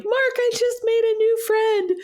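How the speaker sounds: very high, excited retold squeal; high-pitched, playful excitement; giddy announcement. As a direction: gush the news like an excited child. Retells announcing new friend to husband.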